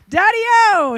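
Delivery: loud high call to Daddy. Retold or imitated. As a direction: shout across the yard. Calling dad from the hill.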